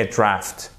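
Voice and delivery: "Draft" with a short A. Shown as a demonstration. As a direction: say "Draft" without stretching the vowel. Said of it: "Et ça, c'est un A court".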